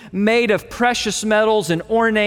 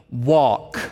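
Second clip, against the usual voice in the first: firm command; 8 semitones lower than usual. Shown as a demonstration.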